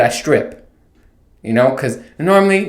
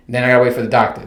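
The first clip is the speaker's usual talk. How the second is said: usual speaking voice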